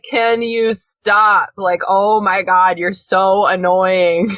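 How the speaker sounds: very slow, drawn-out annoyed plea; exasperated. Pretend. Podcaster imagining scolding a noisy house elf, drawn-out exasperation. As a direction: drag each word out, fed up. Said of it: "I'd be like".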